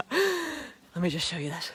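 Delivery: gasping out the words after the climb; out of breath. Real, not acted.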